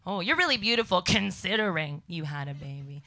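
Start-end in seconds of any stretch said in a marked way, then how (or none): none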